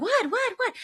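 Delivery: high excited what; eager what. Retold or imitated. The family urging dad on, high and eager. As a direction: lean in, dying to know. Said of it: "we're like".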